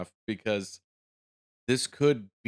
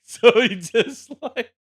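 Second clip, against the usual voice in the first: laughing; 6 semitones higher, 7 dB louder, slower than usual. Real, not acted. Laughing while picturing the scene.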